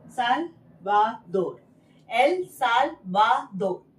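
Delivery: साल्वाडोर said slowly, Spanish style, rolled r; teacher models the Spanish name slowly; Spanish pronunciation. Shown as a demonstration. Teacher drills Spanish country name, slow and clear. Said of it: "Spanish में एल".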